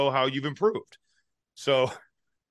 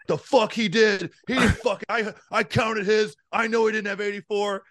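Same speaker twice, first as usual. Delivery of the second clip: retold freak-out, pitched way up and indignant; 9 semitones higher than usual. Teammate imitating Ben losing it over a drill count, outraged high yell. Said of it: "And Ben freaks".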